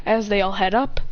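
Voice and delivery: usual speaking voice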